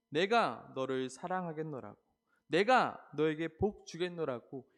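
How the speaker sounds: preacher booming God's vow; fervent promise. Acted. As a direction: cry out God's promise to the people.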